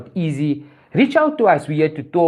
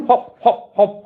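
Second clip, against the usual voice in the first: ordinary delivery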